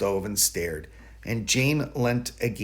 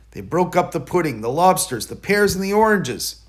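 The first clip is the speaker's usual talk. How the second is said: rising excitement; 6 semitones higher, 5 dB louder than usual. A narrator's reading. Rage of the mice.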